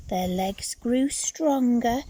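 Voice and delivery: ordinary delivery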